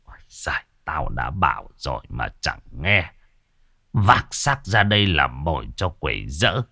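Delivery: put-on low, soft, heavy voice of an old exorcist; grave old master. A narrator's reading. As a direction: speak low and grave, full of foreboding. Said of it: "khuôn mặt nặng nề".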